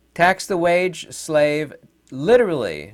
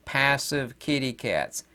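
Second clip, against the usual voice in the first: ordinary delivery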